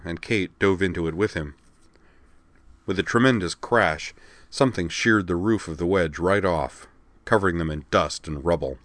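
usual speaking voice

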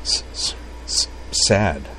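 repeated hissed S before "sad"; quiet, halting stutter; stammering. A narrator's reading. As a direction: stick softly on the S, then release "sad". Kate stammers in story.

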